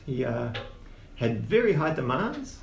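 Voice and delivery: usual speaking voice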